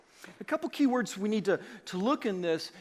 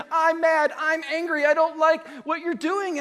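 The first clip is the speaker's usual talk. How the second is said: higher, louder, fast grumbling; mock angry complaint; 8 semitones higher, 8 dB louder than usual. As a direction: play the upset member complaining. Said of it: "they say".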